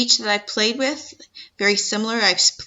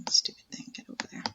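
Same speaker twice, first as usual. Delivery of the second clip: annoyed whisper under breath; whispered grumble; 9 dB quieter, faster than usual. Real, not acted. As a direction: mutter it to yourself, irritated. Muttering at stubborn software clip.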